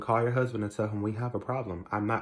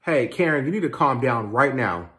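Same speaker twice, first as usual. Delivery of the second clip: loud, raised-voice warning; heated confrontation; 3 semitones higher, 8 dB louder than usual. Acted. Stepson telling shouting stepmother to calm down, heated. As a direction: talk back forcefully to cut her off.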